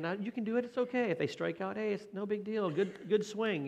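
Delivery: encouraging parent. Retold or imitated. Parent comforting a child at a little league game, high and reassuring. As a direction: reassure the kid after a strike-out. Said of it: "you're saying".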